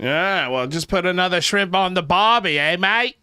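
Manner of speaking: put-on high, loud ocker Aussie voice, Australian accent; overdone Australian accent. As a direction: ham up the Australian accent on the barbie line.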